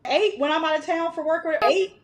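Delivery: high-pitched disbelieving questions; incredulous. Retold or imitated. Mother hearing kids slept early for nana, incredulous. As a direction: retell it as if you can't believe it. Retold disbelief at nana's bedtime success.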